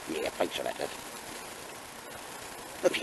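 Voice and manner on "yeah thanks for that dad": dog voice. Pretend. Voicing the dog.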